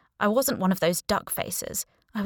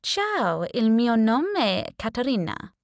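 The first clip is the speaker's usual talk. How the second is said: put-on confident Italian accent; 4 semitones higher, slower than usual. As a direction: greet the housemate in a smooth Italian accent. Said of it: "The Italian accent came out perfect".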